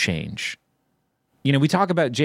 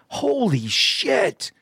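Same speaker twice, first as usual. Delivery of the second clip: imitated amazed kid; 10 semitones higher than usual. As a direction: mimic a schoolkid blown away. Imitating amazed kids watching footage.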